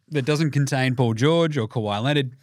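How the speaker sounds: ordinary talk, nothing marked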